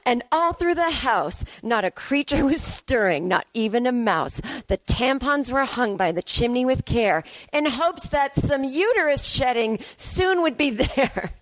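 slow sing-song verse reading; poem recitation. Acted. Spoofing a Christmas poem recitation.

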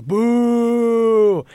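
one long, high, loud "boo" held out; imitated crowd holler. Crowd greeting Boo Weekley's sunk putt, drawn-out imitated holler. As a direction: bellow it like a whole gallery of fans. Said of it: "they would go".

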